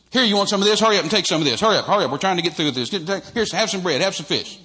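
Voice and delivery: loud and rushing, pushing the food out; an impatient disciple barking at the crowd to hurry; hurried Peter. Retold or imitated. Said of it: "Peter would have gone over there and said".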